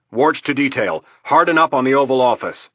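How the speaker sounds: loud barked order into a mic; barked command. A narrator's reading. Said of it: "barked out the command".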